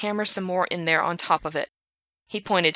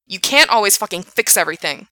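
angry snap; 7 dB louder than usual. Acted. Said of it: "Frank snapped".

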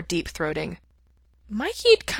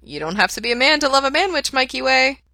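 innocent, higher and louder; 8 semitones higher, 4 dB louder than usual. A narrator's reading. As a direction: say it all innocence. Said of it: "quite innocently".

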